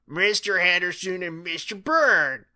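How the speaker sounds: high, drawn-out teacher's call; Missus King. A narrator's reading. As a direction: summon two pupils slowly from behind the desk. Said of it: "called Missus King from behind her desk".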